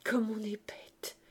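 slow, whispery exclamation to himself; dazed, halting murmur; faltering stammer. A narrator's reading. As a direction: falter it out, half-voiced. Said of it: "Jean Valjean balbutiait".

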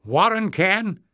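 high loud call; calling back. A narrator's reading. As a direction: call back across the distance. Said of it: "Clef called back".

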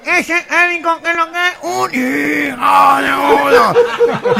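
voz nasal de megáfono, aguda; gangoso con megáfono. Acted. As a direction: imita una consigna gangosa por megáfono. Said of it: "uno en un megáfono dice". (arenga gangosa por megáfono)

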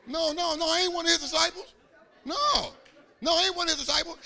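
high, loud mock outrage; hammed-up protest, fast and insistent; indignant cussing denial. Peter denying he is a disciple, hammed-up indignant outburst. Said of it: "He started cussing".

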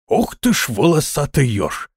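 gasping in wonder; amazed exclamation. Acted. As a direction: blurt it out in awe.

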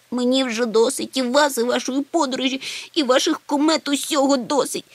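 high-pitched wailing complaint; tearful wailing. A narrator's reading. Little Chmykh fed up with the comet trip, wailing. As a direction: whine it out, close to sobbing. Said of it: "заголосив маленький чмих і розридався".

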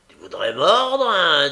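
loud high snarling threat; furious jeering shout; angry taunt. A narrator's reading. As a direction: threaten the dog, loud and mean. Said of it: "dit Sikes".

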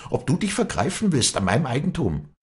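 high-pitched, indignant demand; blazing anger. A narrator's reading. Walli defends her property from Josef, furious and high. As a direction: order him off in fury. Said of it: "rief sie in flammendem Zorn".

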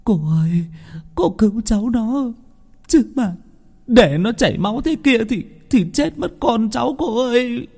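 very high, wailing mother's plea; helpless weeping entreaty; tearful begging. Acted. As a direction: beg through tears, pitch right up. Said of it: "vô lực mếu máo nhìn".

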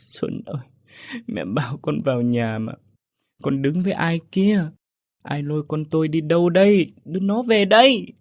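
a grieving mother wails for her son, rising to a plea; wailing mother. A narrator's reading. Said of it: "vừa ngồi vừa gạo khóc con". (người mẹ gào khóc gọi con, từ thì thầm nghẹn ngào đến gào to)

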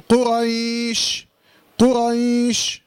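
قريش chanted with the "ay" glide held long before a final sh; lengthened leen glide. Shown as a demonstration. Teacher showing how to stop on قريش, drawn-out recitation. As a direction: stretch the ي of قريش on a level note. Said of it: "هنا اللين بيعامل معاملة المد".

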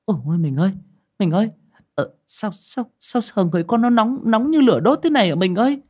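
very high, loud panicked wail; panicked stammer. Acted. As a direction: stammer in rising panic to her husband. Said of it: "bà lắp bắp".

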